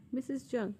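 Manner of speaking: ordinary delivery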